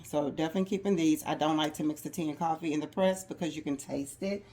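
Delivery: normal talking voice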